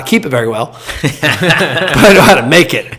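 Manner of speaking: chuckling through the words; laughing aside. Real, not acted. Joking about his own spending, laughing as he admits it. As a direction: laugh at yourself while saying it.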